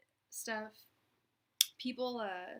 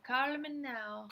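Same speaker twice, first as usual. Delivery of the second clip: slow, drawn-out mock Jamaican psychic line; slow Jamaican-accented sales pitch; Miss Cleo Jamaican accent; 4 semitones higher, 5 dB louder, slower than usual. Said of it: "she had a fake Jamaican accent".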